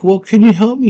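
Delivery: high-pitched mimicry of a girl; girl's voice. Sarah politely addressing the little guy, high girl voice. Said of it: "She's like".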